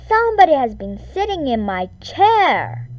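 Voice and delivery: loud big bear bellow; bellowing Papa Bear. A narrator's reading. Papa Bear finds his chair pushed, loud bellow. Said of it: "he bellowed in his great big voice".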